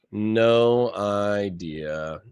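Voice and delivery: "No idea" stretched very slowly; slow drawn-out no idea. Shown as a demonstration. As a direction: drag out "No idea" word by word.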